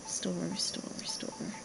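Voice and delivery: whispered under the breath. Real, not acted. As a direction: breathe the words, no voice. Whispering button commands to himself.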